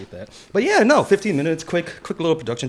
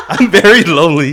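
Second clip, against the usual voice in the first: laughing; 8 semitones higher, 10 dB louder than usual. Real, not acted. Joking about being lonely.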